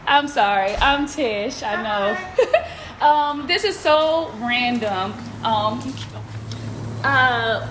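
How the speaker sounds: nervous, high and giggly; jittery voice when approaching a stranger; stage fright. Real, not acted. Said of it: "I'm a little nervous".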